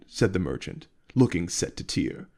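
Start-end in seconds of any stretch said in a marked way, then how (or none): none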